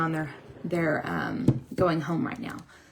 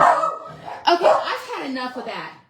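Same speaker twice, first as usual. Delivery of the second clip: high, loud scold with a laugh; 10 semitones higher, 7 dB louder than usual. Real, not acted. Owner laughs while scolding her dogs, laughing scold. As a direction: tell off the dogs through laughter.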